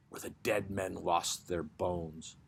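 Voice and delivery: ordinary delivery, nothing marked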